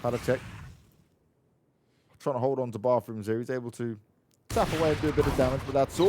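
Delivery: ordinary talk, nothing marked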